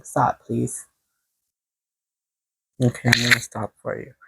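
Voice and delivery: quiet plea. Real, not acted. Asking her shaking spirit team to stop, softly. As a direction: softly beg them to stop.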